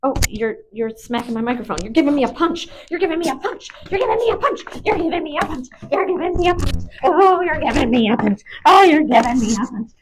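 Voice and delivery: high, excited mock protest; playful dog talk. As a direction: repeat it like a squeaky game with the dog. Playful teasing of dog punching her.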